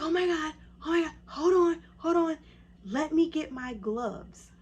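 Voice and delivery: high, loud panicky husband; freaking-out husband. Retold or imitated. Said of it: "He's like".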